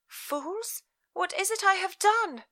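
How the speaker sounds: put-on high, startled young woman; bewildered daughter. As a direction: ask in alarmed confusion. Elizabeth's voice in story.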